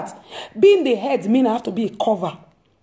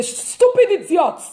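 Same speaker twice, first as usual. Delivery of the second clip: mock insult; 6 semitones higher, slower than usual. Parent cursing daughter or son.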